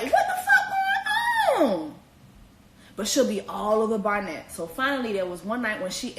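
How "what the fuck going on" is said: very high, stretched disbelief; incredulous mock shriek. [name] baffled by Jessica, very high stretched disbelief. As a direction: wail it out in shock.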